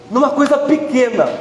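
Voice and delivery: ordinary talk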